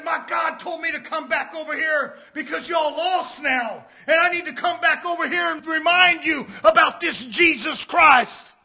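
visiting preacher's charge, pressed out loudly; urgent sermon delivery; forceful preaching. Retold or imitated. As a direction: build to a loud pulpit proclamation. Preaching in quoted preacher's words.

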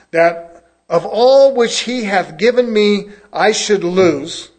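loud, slow, solemn King James reading; booming pulpit scripture reading. Acted. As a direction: proclaim the verses like a preacher.

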